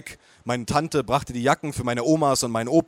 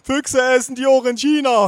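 put-on much higher, louder old-woman wail; wailing grandma; 11 semitones higher, 7 dB louder than usual. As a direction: lament it like a worried granny. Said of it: "heulte meine Oma".

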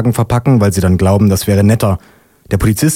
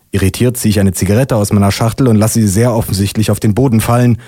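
ordinary delivery, nothing marked

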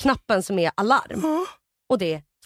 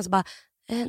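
ordinary delivery

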